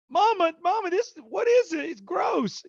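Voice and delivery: put-on very high-pitched little kid voice; little son. Toddler son to mother in the yellow water, high disgusted kid voice. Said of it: "Brooks is, is on me and he goes".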